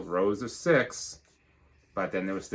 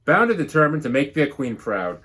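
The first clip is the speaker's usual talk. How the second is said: lively storybook read-aloud; bouncy rhyming storybook narration; reading to children; 5 semitones higher, 7 dB louder than usual. Acted. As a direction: read it like a picture book to the class. Teacher reads picture book.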